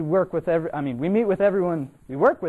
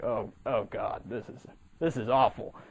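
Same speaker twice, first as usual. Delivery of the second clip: hushed dismay; 6 semitones lower, 6 dB quieter than usual. Retold or imitated. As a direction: mutter it low, sinking into dread. Teller voices his dismay.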